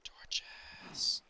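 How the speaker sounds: unvoiced, breathy word to himself; whispered aside. Real, not acted. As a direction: whisper it to yourself. Quiet aside.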